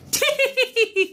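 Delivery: high tee-hee giggle; tittering. Acted. Becca giggles at checkers.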